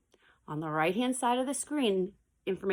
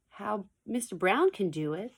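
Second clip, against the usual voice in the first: ordinary delivery, nothing marked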